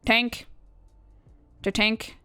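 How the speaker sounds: ordinary talk, nothing marked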